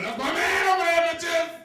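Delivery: high, loud brag to the room; indignant shout. Retold or imitated. Showing off his man in the room, indignant shout. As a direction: shout it proudly across the room. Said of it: "we get indignant".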